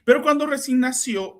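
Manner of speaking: ordinary talk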